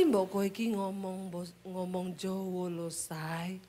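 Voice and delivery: low, very soft and slow coaxing; husband urging wife to speak Javanese, softly. Retold or imitated. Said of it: "Berulang-ulang tiap hari".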